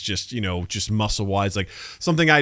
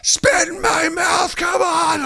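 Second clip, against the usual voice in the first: much higher, shouted hype yelling; mock gym-bro yell; much slower than usual. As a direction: scream like a gym buddy spotting a lift. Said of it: "someone to scream at 'em while they're lifting".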